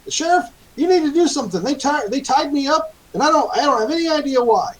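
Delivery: very high-pitched, agitated protest; bewildered high-voiced captive pleading his case; confused prisoner. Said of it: "He still looks pretty confused".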